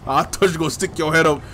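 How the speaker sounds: exasperated mock telling-off; mock scolding. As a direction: scold Leon loudly, only half serious. Mock scolding game character Leon.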